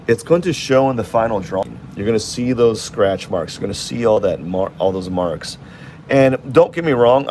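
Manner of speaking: usual speaking voice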